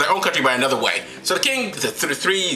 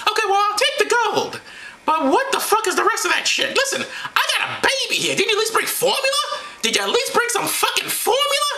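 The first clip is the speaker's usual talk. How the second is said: high falsetto scolding; comic woman's voice pitched way up; indignant Mary. Acted. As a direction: scold them in a squeaky-high voice. Acting Mary scolding the wise men.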